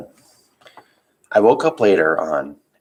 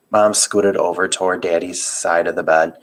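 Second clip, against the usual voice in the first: usual speaking voice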